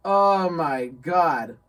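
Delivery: very slow, loud "oh my God"; drawn-out, laughing dismay; emphatic exasperation. Real, not acted. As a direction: stretch each word for dramatic effect. Dramatic reaction to bad wheels.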